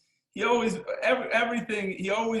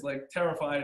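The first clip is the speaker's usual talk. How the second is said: ordinary talk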